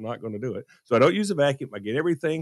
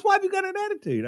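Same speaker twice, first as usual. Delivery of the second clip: wife questioning; faster than usual. Retold or imitated. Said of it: "She goes".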